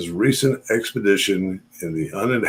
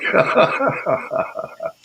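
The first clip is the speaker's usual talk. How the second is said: high breathy laugh; 13 semitones higher than usual. Real, not acted. Laughs at Facebook ban.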